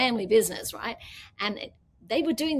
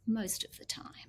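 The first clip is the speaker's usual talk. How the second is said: whispered aside; 8 dB quieter than usual. Real, not acted. Wry aside about their rows.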